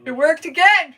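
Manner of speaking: high, loud delighted cry; excited squeal. Real, not acted. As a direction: squeal with joy. Delighted that it worked.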